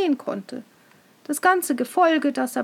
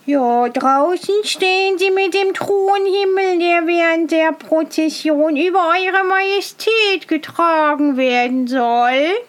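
pompous master of ceremonies; 5 semitones higher, 5 dB louder than usual. Acted. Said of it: "sagte der Oberzeremonienmeister".

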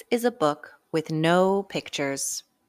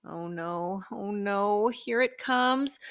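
slow mock dread; slower than usual. Dread before silly sounds.

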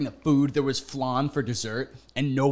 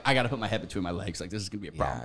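much softer, lower, fast; hushed panic; 8 semitones lower, 8 dB quieter, faster than usual. Retold or imitated. His stoned inner voice on the plane, soft and low. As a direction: mutter it to yourself in panic.

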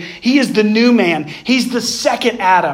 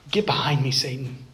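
quiet stern rebuke; 8 semitones lower, 8 dB quieter than usual. Retold or imitated. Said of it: "And he says".

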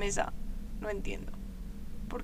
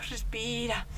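much softer, lower; soft self-calming; 5 semitones lower, 9 dB quieter than usual. Acted. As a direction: gently tell yourself to breathe. Calming herself down.

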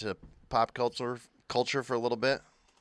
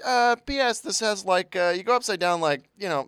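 high, louder teasing imitation; high mock voice; 8 semitones higher, 8 dB louder than usual. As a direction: squeak it out like a nervous warning. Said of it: "you're like".

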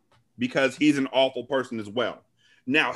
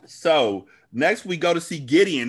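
normal talking voice

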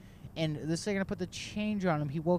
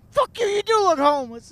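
much higher and louder yell; angry shout back; 10 dB louder than usual. Retold or imitated. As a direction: scream it back in fury. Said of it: "And I was like".

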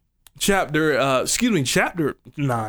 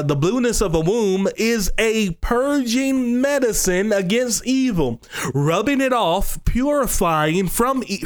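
higher and slower, building intensity; fervent scripture reading; 4 semitones higher than usual. Acted. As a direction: read it like it's setting you on fire. Reads scripture with rising fervor.

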